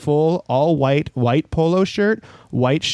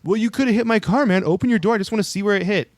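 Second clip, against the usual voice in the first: higher-pitched mock protest at usual volume; imitated insistent complaint; 7 semitones higher, faster than usual. Car owner demanding the door be opened, imitated higher-pitched complaint. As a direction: play the aggrieved driver, voice pitched up.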